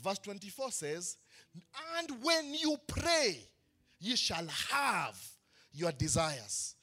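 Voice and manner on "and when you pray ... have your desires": slow, drawn-out recitation; slow scripture reading. Acted. Preacher reciting verse twenty-four slowly. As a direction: draw each phrase out slowly.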